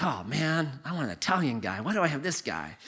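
low, soft mock whine; hushed, let-down inner moan; private grumble. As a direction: complain quietly to yourself. Private grumbling about his passenger.

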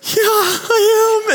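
high, slow voice breaking into tears; tearful. Retold or imitated. Volunteer answering the child, high slow and close to tears. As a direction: answer while choking up. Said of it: "brought her to tears".

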